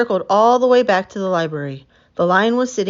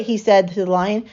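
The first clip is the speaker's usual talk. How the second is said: usual speaking voice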